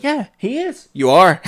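high squeaky agreement. Retold or imitated. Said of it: "Joe Pesci's like".

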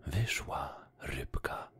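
plain reading voice

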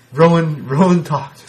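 loud, high-pitched astonished exclamation; mock amazement. Podcaster retelling his reaction to Rowan's promo, loud amazement. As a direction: blurt it out as a hammed-up reaction to the unbelievable.

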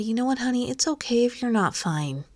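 soothing, lifted, quiet voice; comforting a child. Acted. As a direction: gently let a worried child off the hook. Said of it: "like you would comfort a child".

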